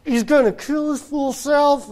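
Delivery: high mock mom voice; a hint of a fretting mother; worried mom. Mom watching her son in the tree, mildly alarmed. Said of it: "is going".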